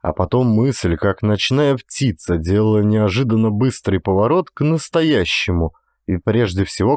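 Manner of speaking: ordinary delivery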